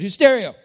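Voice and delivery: anglicised "Who's stereo", projected for a congregation to copy; Texan pronunciation. Shown as a demonstration. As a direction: lead the room in "hoos-STAIR-ee-oh". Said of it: "that's how we're gonna say it".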